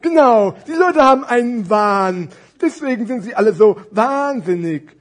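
high, loud, carefree character ramble; exaggerated storybook puppet voice; muddled fat scarf. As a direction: muse aloud, stretching the muddled words.

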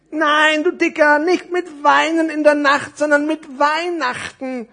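high, loud, slow correction; hammed-up storybook impatience; exasperated necktie. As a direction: scold in a small character's voice, stretching words. Necktie scolds and corrects scarf.

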